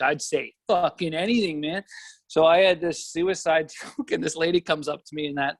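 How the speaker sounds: ordinary talk, nothing marked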